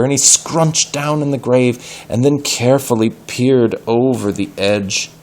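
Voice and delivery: ordinary delivery, nothing marked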